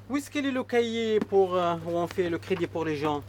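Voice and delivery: calling out. Real, not acted. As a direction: call across the shop to a colleague. Calls to Michel across shop.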